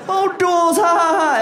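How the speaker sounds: high mocking sing-song jeer; mock him in a squeaky taunting voice; mocking laugh. People mocking the alcoholic's beer, high and jeering. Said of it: "They're just like".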